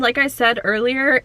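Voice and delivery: ordinary talk, nothing marked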